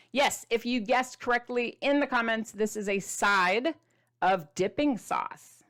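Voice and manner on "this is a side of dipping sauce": slow reveal. Acted. Drawn-out reveal.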